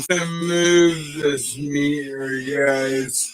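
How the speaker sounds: drawn-out slow-motion voice; slow motion replay. Shown as a demonstration. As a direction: stretch every word like slow motion. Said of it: "super slow motion".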